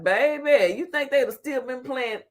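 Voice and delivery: normal talking voice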